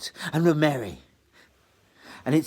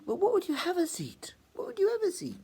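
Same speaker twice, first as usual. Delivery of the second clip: very high imploring question; pleading girl; faster than usual. Acted. Meg pleads with Trite, very high and imploring. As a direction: plead on behalf of the poor.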